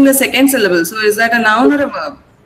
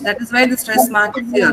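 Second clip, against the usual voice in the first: usual speaking voice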